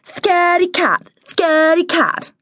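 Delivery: high sing-song playground taunt. A narrator's reading. Girl taunting her scared brother, high sing-song. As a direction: chant it high, dropping at each end. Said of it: "a girl's voice taunted".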